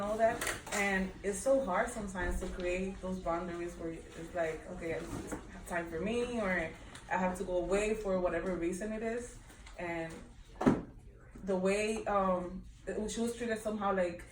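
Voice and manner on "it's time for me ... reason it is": normal talking voice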